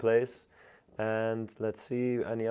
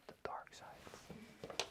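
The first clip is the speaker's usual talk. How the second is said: slow, voiceless whisper on a playful echo; 22 dB quieter, much slower than usual. Pretend. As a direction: whisper it slowly, drawn out and very soft. Phrase echoed in a whisper.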